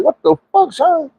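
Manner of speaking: very high, alarmed what-the-fuck; hearing the drug's name and freaking out; shocked squeal. Retold or imitated. Told it's laced with sherm, a very high shocked squeal.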